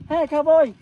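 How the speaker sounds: high, loud hail across the street; calling out. Retold or imitated. Stranger on the street hailing him, loud call. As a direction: shout it like a stranger hailing you.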